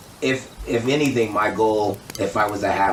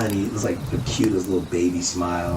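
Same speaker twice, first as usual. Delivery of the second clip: ordinary talk, nothing marked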